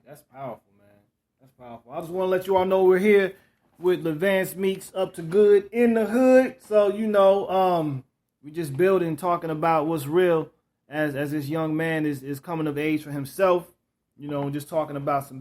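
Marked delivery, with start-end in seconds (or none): 1.9-13.7 louder, upbeat show-host read; podcast host breaking in to introduce the guest, louder and upbeat; host announcement. Acted.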